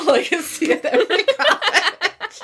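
high giggling repeat; laughing. Real, not acted. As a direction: giggle the words out. Joke shared, laughing heard.

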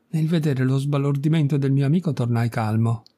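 ordinary delivery, nothing marked

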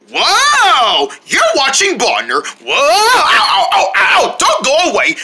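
high, yelping show promo; hyped bumper announcer. Acted. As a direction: whoop and yelp into the break. Show announcer before the break.

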